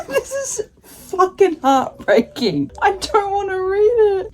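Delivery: slow, high, laughing through sobs; real tears breaking through; crying. Real, not acted. Vlogger reading a sad ending, real crying. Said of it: "Tears are coming".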